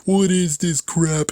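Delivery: high, slow, drawn-out mocking whine; mock whiny complaint. Viewers poo-pooing his Home Alone commentary, mocked in a high whine. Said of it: "poo-pooed on because they're like".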